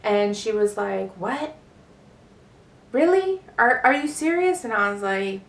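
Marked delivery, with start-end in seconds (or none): 1.2-4.6 higher-pitched, stunned questioning; mom's disbelief. Retold or imitated. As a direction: imitate a mother stammering in disbelief. Mom reacts to coming out.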